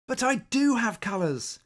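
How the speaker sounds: high, earnest little cry; pleading cry. Acted. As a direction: protest eagerly, voice up high.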